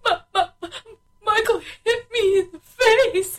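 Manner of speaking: high, whiny crying complaint; mock crying. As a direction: imitate a sobbing little girl. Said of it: "she goes".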